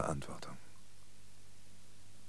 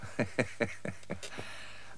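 long breathy mocking chuckle; scornful laugh; 5 dB quieter than usual. Old man ridicules intergalactic pastoral care, scornful laugh. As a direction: laugh off the grand plan. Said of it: "lach nicht so höhnisch".

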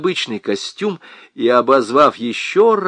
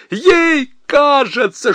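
much higher, louder, slow mocking shout; 14 semitones higher, 5 dB louder, much slower than usual. Husband shouts back at his wife.

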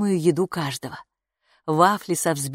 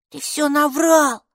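high, slow, loud angry accusation; hissed accusation; 10 semitones higher, 5 dB louder, slower than usual. A narrator's reading. Майки confronts Капо about lying, high angry hiss. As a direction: accuse bitterly, stretching the words. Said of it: "зашипела она".

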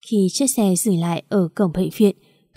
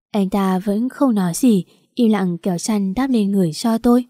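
plain reading voice

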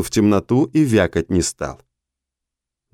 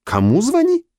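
much higher, incredulous repeat; astonished. A narrator's reading. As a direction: echo it in disbelief. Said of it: "изумилась Светка". (изумлённо, очень высоко)

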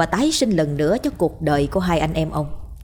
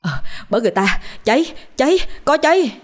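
la lớn báo cháy; 7 semitones higher than usual. A narrator's reading. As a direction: burst in shouting a fire alarm, pitch leaping up. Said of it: "la lớn". (la toáng lên báo cháy)

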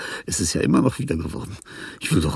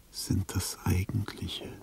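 whisper; 2 semitones lower, 10 dB quieter, slower than usual. Acted. Reflective closing thought.

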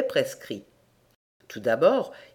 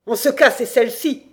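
loud, higher-pitched impatience; irritated outburst; 6 semitones higher, 7 dB louder than usual. Acted. Damned landlord offering a second receipt, loud and irritated.

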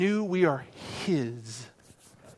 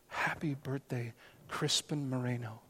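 soft tender whisper; 6 semitones lower, 15 dB quieter than usual. Retold or imitated. Nurse speaking tenderly.

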